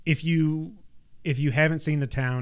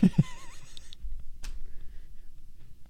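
laugh; 5 dB quieter than usual. Real, not acted. Laughing at own impression.

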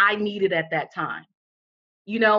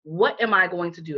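ordinary talk, nothing marked

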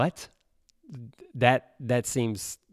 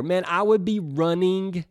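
usual speaking voice

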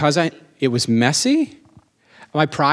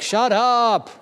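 high, exasperated retold snap; mock annoyance; 9 semitones higher than usual. Annoyed listener silencing churchgoers, high mock exasperation. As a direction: scold the yellers in a raised pitch.

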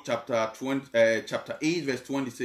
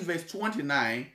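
usual speaking voice

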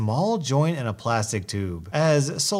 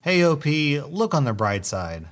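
ordinary delivery, nothing marked

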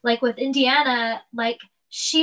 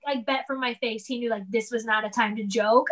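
ordinary talk, nothing marked

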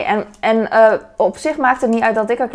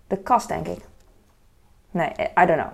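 usual speaking voice

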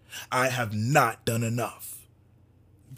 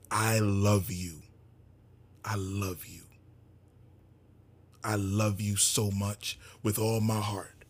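tender; 3 semitones lower, slower than usual. Real, not acted. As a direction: tell them gently, from the heart.

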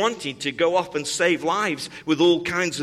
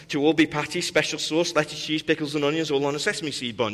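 fast jingle recital, words run together; rattling off the jingle; faster than usual. Retold or imitated. Speaker reciting the Big Mac jingle fast for the congregation. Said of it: "could name the seven ingredients".

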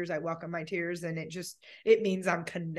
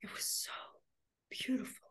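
hushed, halting, choked-up voice; near tears; 5 dB quieter than usual. Real, not acted. Grieving owner remembering the trail with her dog, near tears. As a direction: let it drop almost to a whisper. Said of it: "as I start becoming a crying mess".

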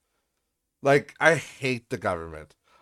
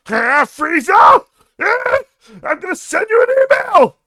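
shrieking anime hero yell, much higher and louder; Goku battle cry; 11 dB louder than usual. Pretend. Mock anime fight, Goku yelling at Frieza. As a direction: scream it like Goku powering up on Frieza. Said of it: "That was pretty good, Goku".

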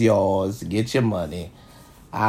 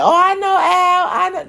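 mock boaster. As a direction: toss it off like a fake friend. Mocking people who claim to know him.

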